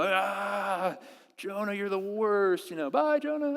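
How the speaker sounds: high, playful brush-off; mock dismissive God. Imagined God dismissing Jonah, mock breezy goodbye. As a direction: wave him away with a cheeky singsong.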